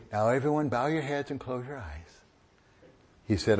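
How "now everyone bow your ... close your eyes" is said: mock solemn hush. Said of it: "God didn't say".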